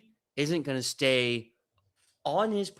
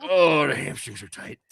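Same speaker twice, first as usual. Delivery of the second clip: a lightly voiced brute, complaining about stiff muscles; groaning flesh construct; 3 semitones higher, 7 dB louder than usual. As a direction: grumble like a huge creature with tight hamstrings. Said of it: "you can hear him go".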